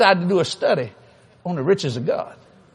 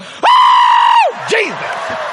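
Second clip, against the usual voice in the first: waitress going crazy; 6 dB louder, much slower than usual. Retold or imitated. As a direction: imitate an overwhelmed woman shrieking. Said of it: "She just went crazy".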